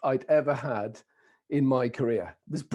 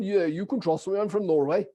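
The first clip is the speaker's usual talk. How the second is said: put-on lilting Norwegian accent; 3 semitones higher than usual. Traveler reassuring a guy at the airport, Norwegian accent. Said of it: "with a Norwegian accent too".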